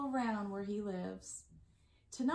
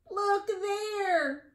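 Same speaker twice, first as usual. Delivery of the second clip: a higher, louder call of look there; bellowing; 7 semitones higher, 4 dB louder than usual. Acted. Badger calling to his friends at the pond, bellowing. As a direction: call out like a big badger spotting something. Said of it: "Badger bellows".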